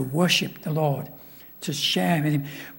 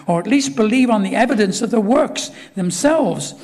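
raised, earnest preaching voice; preaching Jesus' words; 4 semitones higher, 5 dB louder than usual. Acted. Preacher voicing Jesus to Philip, earnest and raised. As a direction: proclaim it like Christ speaking to Philip.

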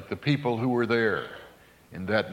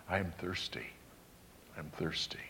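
much softer, lower, a weak dying voice; faint and parched; 6 semitones lower, 14 dB quieter than usual. Retold or imitated. Preacher voicing dying Jesus asking for drink, faint and parched. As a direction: let it fall almost to nothing, parched.

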